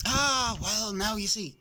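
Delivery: put-on a nosy old bird bursting with news; chatty old crow. A narrator's reading.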